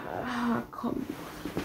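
very soft, whispered repeat; barely voiced, to oneself; under the breath. Real, not acted. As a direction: mumble it quietly to yourself while thinking. Repeats the line to himself.

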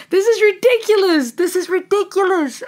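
high, stunned exclamation; overwhelmed. Real, not acted. Reacting to the singer, a high overwhelmed exclamation. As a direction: gush in disbelief.

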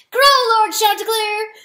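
very high, loud rallying shout; buoyant cry. A narrator's reading. Weasel urging the rooster to crow, buoyant cry. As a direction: cheer your lord on at full voice. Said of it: "the weasel cried buoyantly".

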